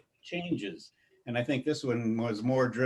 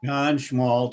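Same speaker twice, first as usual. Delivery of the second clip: ordinary talk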